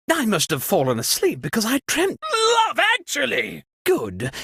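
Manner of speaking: ordinary talk, nothing marked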